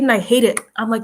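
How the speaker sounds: usual speaking voice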